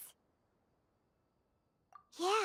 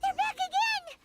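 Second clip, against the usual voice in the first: alarmed; 7 semitones higher than usual. Acted. Scorpions attack again.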